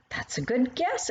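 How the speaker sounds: a touch of delighted encouragement; mild exclaiming. A narrator's reading. Grandmother praising the child's guess, mildly excited. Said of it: "exclaimed Gigi".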